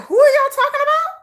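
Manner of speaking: high, incredulous mock question; confused actor. Fan imagining the actor's reply to K-pop fans, confused.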